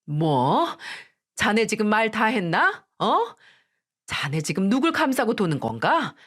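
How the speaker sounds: mother berating her son-in-law, outraged; furious scolding. Acted.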